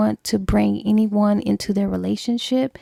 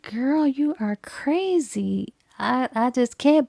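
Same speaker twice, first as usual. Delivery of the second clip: incredulous; 3 semitones higher, slower than usual. Acted. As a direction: draw it out, balking at your friend. Friend balks at repeated proposal.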